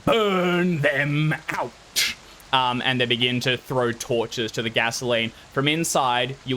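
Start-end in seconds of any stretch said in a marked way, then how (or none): 0.1-1.7 slow, drawn-out chant, pitched up; big kid's menacing call. Acted. Big kid ordering the others to burn the house, menacing. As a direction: stretch each word into a threatening order. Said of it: "the big kid, goes".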